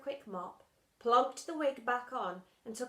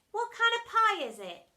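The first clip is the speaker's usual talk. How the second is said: put-on 1 girl voice; 12 semitones higher, 9 dB louder than usual.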